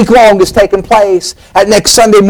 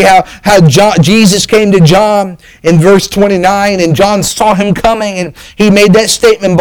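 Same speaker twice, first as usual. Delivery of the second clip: normal talking voice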